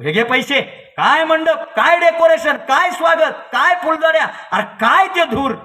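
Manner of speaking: high, loud gushing; effusive praise. Retold or imitated. Father paying the tent decorator, gushing praise loud and high. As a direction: heap praise in ringing bursts.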